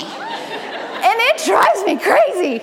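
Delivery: slow, laughing through the words. Real, not acted. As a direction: laugh at your own frustration. Laughing about clingy kids.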